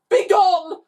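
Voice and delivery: high, loud screamed command; screaming. A narrator's reading. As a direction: scream it in panic. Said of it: "they both screamed".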